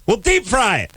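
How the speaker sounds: high, jokey kitchen worker voice; hammed-up cook pitching an idea; mock cook voice. Imagined Mexican lab worker.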